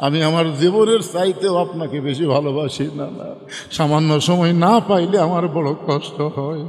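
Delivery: low, melodic lament; acted tearful pleading. Hussein crying to his grandfather, tearful chanted plea. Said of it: "মুখ লাগায় কান্দে আর বলে".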